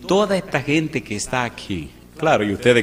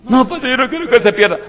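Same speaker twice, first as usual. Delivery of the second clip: much higher, louder, pleading wife voice; imitating the wife; 11 semitones higher, 8 dB louder than usual. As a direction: play the nagging señora, high and insistent. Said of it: "la señora... ataca que ataca". (imitando a la señora, aguda y quejosa)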